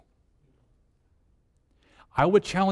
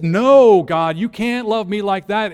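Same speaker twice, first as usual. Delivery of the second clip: loud, higher refusal to God; protesting cry; 5 semitones higher, 8 dB louder than usual. Acted. Believer loudly refusing God's love. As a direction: push God's love away with force. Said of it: "That says".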